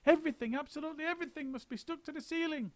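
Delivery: very high, fast rattling list; high rushing monkey list; frantic list. A narrator's reading. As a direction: reel it all off breathlessly. Mugglewump listing orders.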